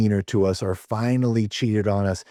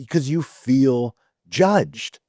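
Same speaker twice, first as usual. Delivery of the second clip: ordinary talk, nothing marked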